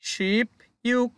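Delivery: শিপ ইউক said slowly, each word drawn out and separated; careful numeral pronunciation. Shown as a demonstration. Teacher modelling শিপ ইউক for students, slow and careful. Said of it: "তাহলে ও".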